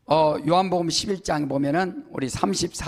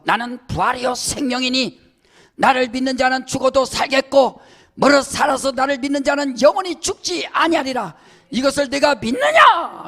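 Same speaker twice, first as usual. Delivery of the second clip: loud, higher ringing proclamation of the verse; preaching at full force; 6 semitones higher, 6 dB louder than usual. Acted. Preacher booming Jesus' words to the congregation, full force. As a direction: proclaim it to the whole church, full voice.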